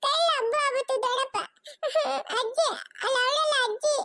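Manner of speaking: put-on high, sobbing child telling tales to uncle; crying little child. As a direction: complain tearfully, whimpering between phrases. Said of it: "ಯಾಕಮ್ಮ ಇನ್ನೂ ಅಳ್ತಾ ಇರೋದು".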